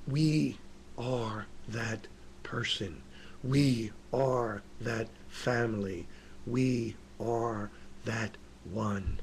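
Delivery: hushed solemn sermon line. Acted. Solemn repeated preaching line.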